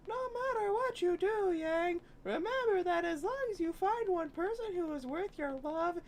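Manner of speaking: a high, lightly put-on father figure; father's voice. Father's advice read in voice.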